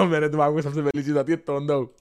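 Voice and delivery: laughing through the sentence. Real, not acted. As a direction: giggle while saying it. Laughs about the word. (हँसते हुए बोलो)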